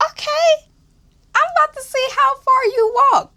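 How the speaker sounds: hammed-up squeaky high challenge; mock sassy challenge. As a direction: pitch it way up like a sassy taunt. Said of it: "And I'm like".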